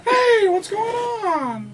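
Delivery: high, loud squeaky cookie voice; Gingerbread Man. Shown as a demonstration. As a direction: shout it like a tiny frantic cookie. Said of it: "the gingerbread man from Shrek".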